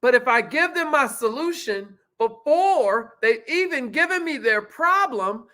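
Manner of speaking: ordinary talk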